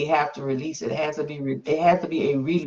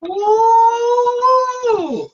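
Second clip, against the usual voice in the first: a much higher, louder cry of joy; ecstatic ooh; 6 dB louder than usual. Real, not acted. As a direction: let out a high, loud praise cry. Moved by testimony, praising God.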